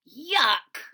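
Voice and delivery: put-on a childish grossed-out yuck; disgusted yuck. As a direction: spit it out like a kid who hates oatmeal.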